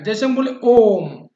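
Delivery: ओम held three times as long, slow and loud; long drawn-out ओम; stretched Om. Shown as a demonstration. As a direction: draw out ओम like a chant. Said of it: "प्लुप्त में तिगुना time लगता है".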